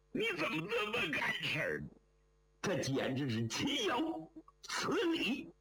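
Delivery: high-pitched, indignant outburst; exasperated scolding. A narrator's reading. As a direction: let the worried scolding boil over, pitch leaping up. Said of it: "于德福急得直跺脚". (又急又气地高声责备)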